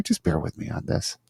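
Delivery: whispered aside, breath only. Real, not acted. Host asking audio listeners for patience, whispered. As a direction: let it drop to a whisper.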